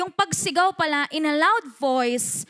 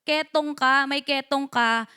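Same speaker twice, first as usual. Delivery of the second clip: ordinary talk, nothing marked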